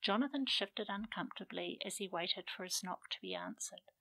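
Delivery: ordinary delivery, nothing marked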